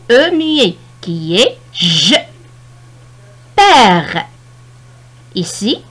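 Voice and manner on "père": "Père" loud, with a long open è. Shown as a demonstration. Teacher showing the grave accent, "Père" with drawn-out open è. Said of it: "E ouvert".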